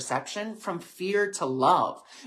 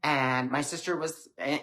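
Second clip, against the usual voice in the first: normal talking voice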